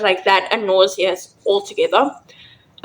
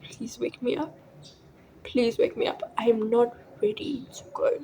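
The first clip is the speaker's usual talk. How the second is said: retold prayer, very soft, nearly whispered; hushed plea; 12 dB quieter than usual. Praying to wake up as eyes close, hushed plea. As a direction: murmur it like a last prayer.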